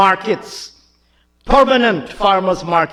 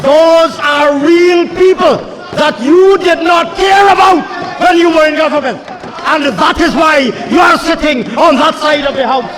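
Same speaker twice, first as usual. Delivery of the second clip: fiery oratory; 6 semitones higher than usual. Acted. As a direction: rail at the opposition benches.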